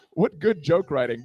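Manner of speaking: ordinary talk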